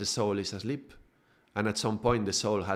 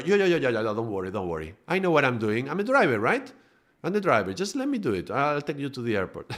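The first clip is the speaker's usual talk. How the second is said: pushy taxi driver; 3 semitones higher, faster than usual. Retold or imitated. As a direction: brush off the worried passenger with fast assurances. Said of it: "And the driver".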